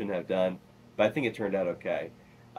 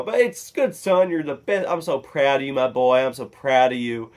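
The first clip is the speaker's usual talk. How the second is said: high, loud mock-proud dad; dad's reaction; 6 semitones higher, 11 dB louder than usual. Dad praising his son, hammed-up pride. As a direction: praise loudly, hamming up the proud father. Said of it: "my dad's reaction to it was".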